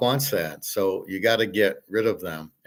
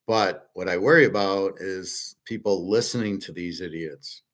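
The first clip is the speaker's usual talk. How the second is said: usual speaking voice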